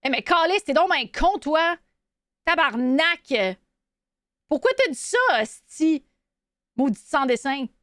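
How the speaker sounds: sarcastic, crass Quebecois swearing; pissed-off Quebecois cursing. Shown as a demonstration. Showing how a Quebecois curses you out, pissed-off Quebecois French. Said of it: "here's a Quebecois French".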